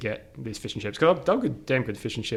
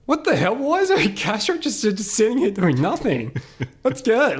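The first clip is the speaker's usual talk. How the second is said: excited discovery; 9 semitones higher than usual. Retold or imitated. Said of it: "I'm like".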